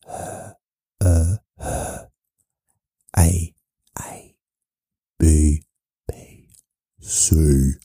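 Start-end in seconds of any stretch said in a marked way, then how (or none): 4.0-4.3 very soft whispered letter. Shown as a demonstration. Demonstrating mouth-only speech, whispered. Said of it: "You use your mouth". 6.1-6.5 very soft whispered letter. Shown as a demonstration. Demonstrating mouth-only speech, whispered. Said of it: "You use your mouth".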